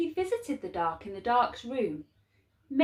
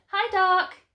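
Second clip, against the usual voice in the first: put-on higher, louder boy's greeting; bright boy's hello, higher and louder; cheerful Laszlo; 10 semitones higher, 6 dB louder than usual. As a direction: greet him like a happy little boy. Boy greets the dark.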